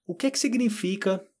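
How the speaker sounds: high-pitched imitation of a student's question; puzzled student asking. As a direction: lift the voice high, mimicking someone asking in a voice message. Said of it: "manda um áudio pra mim e fala".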